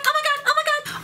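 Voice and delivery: very high, rushed repeats; high panicky babble. Shown as a demonstration. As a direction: chant it in a frantic flutter. Demo series of OMG variants.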